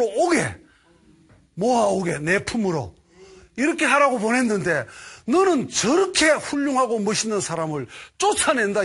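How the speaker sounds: high, loud furious rebuke; God rebuking Moses in fury; thundering God. Retold or imitated. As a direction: boom down like thunder from the sky. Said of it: "천둥 번개가 막 치면서".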